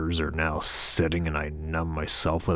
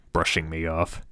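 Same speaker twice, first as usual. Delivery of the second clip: plain reading voice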